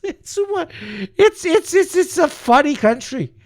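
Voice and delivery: high, giggling words; real laughter spilling into the words; laughing through words. Real, not acted. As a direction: talk while still laughing. Laughing at country's absurdity.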